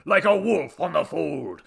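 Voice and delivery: loud, high, grandiose statement falling at the end; pompous declamation. Acted. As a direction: proclaim it as your own epic line. God resumes declaiming with it.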